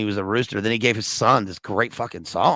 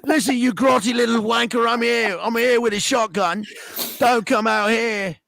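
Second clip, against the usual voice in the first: mock English accent; 11 semitones higher than usual. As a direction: growl threats in an overdone Cockney accent. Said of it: "just do your English accent".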